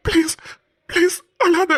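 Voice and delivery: high, tearful plea; pleading. Acted. Aadhi begging the crying bride to stop. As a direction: beg her to stop crying.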